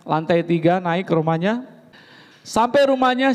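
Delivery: ordinary talk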